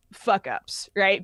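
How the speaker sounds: ordinary talk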